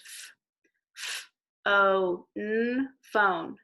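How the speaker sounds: each sound said apart then phone; teacher sounding out phone. Shown as a demonstration. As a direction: segment f, o, n then blend phone. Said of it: "each sound".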